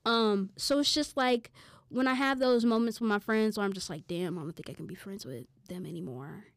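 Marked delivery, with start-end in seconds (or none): none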